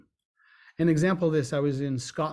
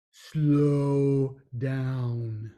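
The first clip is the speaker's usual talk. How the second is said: drawn out slow down; 2 semitones lower than usual. Shown as a demonstration. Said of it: "advises slowing down, shows it".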